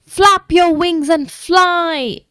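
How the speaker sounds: high, slow urgent shout; yelling. Acted. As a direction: yell at him to fly. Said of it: "yelled Jake".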